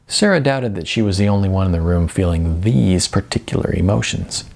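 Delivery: usual speaking voice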